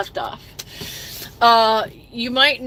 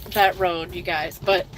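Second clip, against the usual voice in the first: tearful. Real, not acted. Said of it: "I'm sorry I was crying".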